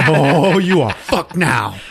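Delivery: high, drawn-out mock threat. Acted gloating after being cut.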